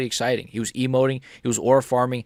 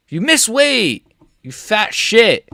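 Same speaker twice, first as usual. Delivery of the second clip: high, loud, drawn-out screaming insults; furious shout; 10 semitones higher, 7 dB louder, much slower than usual. Pretend. Streamer screaming at the fighter who missed weight. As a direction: bellow the insults at him.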